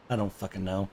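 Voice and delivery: normal talking voice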